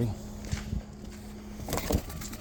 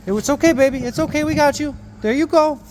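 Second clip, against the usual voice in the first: soothing a scared animal; 8 semitones higher, 5 dB louder than usual. Real, not acted. Soothing trapped birds.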